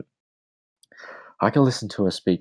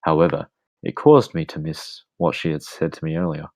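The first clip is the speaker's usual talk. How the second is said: usual speaking voice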